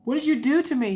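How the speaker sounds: hammed-up indignant question; mock accusation. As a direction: accuse him in playful outrage. Retold accusing of husband.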